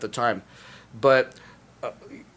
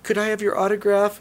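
put-on kid asking shyly; 7 semitones higher than usual. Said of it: "and like".

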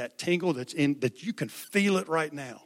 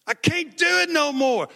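put-on much higher, louder wailing boy's cry; imitating bawling; 13 semitones higher, 11 dB louder than usual. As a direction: reenact the sobbing boy, wailing high. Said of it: "he was bawling".

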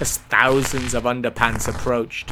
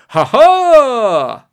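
very loud, high mock-heroic "ha, ha"; triumphant cry; 10 semitones higher, 12 dB louder than usual. A narrator's reading. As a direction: announce yourself with a grand flourish. Grandfather bursts in on villain.

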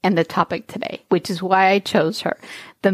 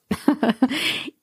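light laugh; 5 semitones higher, 3 dB quieter than usual. Real, not acted. Laughs at her own numbness.

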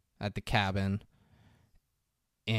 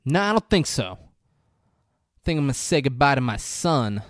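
raised, loud character voice; loud retold refusal; 3 semitones higher, 6 dB louder than usual. Steven turning down Rolf in a retold scene, loud refusal. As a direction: act out a forceful brush-off.

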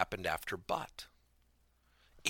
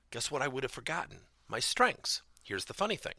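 usual speaking voice

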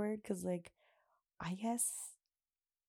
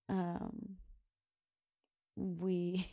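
ordinary delivery

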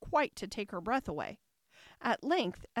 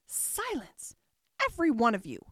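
the Tiger Lily silencing the chattering daisies; commanding cry; 4 semitones higher, 4 dB louder than usual. A narrator's reading. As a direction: Silence pitched high, then a loud every one of you. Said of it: "cried the tiger lily".

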